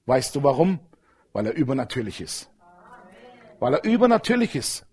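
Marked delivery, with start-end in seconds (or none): 1.3-2.5 "übernatürlich" low and soft, falling at the end; quiet first take. Shown as a demonstration. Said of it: "repeated louder". 3.6-4.8 "übernatürlich" pitched high and proclaimed; raised repeat. Shown as a demonstration.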